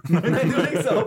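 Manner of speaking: laughing through a playful protest; giggly late night call. Pretend. Mock couple hanging up call.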